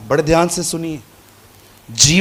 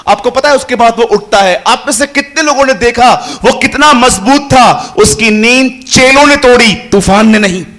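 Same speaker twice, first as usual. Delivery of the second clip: loud, high, rousing sermon; full-voiced pulpit fervour; fervent preaching; 5 semitones higher, 9 dB louder than usual. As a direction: preach it to stir the crowd.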